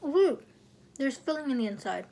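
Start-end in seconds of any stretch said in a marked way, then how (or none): 0.0-0.4 bright surprised ooh; pleasantly surprised. Real, not acted. Discovers filling in donut.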